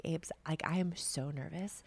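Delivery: soft whispered admission to a teammate; whispering. Retold or imitated. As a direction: breathe it out as a nervous secret. Confiding nerves to a teammate.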